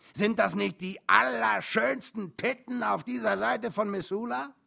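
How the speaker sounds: high, loud enthusiastic question; excited trucker bragging; rapturous boasting. A narrator's reading. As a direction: demand admiration with gusto. Trucker boasts, seeking admiration.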